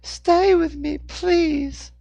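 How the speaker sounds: put-on very high, loud child plea; pleading little boy. A narrator's reading. Boy begging the man to stay, pleading little boy. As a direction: beg in a small boy's high pitch.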